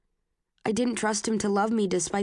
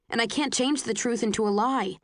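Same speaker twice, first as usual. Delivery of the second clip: usual speaking voice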